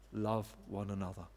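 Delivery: put-on low, soft, frail old man's voice; a hushed, fading repeat of the dying apostle's words; frail whisper. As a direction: barely breathe it out like a dying old man.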